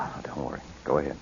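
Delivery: soft breathy whisper, nearly no voice. Acted. Husband whispering to wife as they leave the nursery, whispered. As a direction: keep it down so the baby stays asleep.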